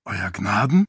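puzzled question, rising at the end; surprised. A narrator's reading. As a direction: ask it in surprise, lifting the last word. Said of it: "Teret blickte überrascht auf".

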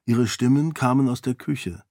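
plain reading voice

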